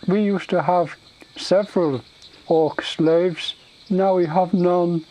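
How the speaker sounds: high, loud, halting ogre voice; halting speech of a dim ogre; simple-minded ogre, regretful. Acted. Ogre explaining the lost slaves to visitors, regretful.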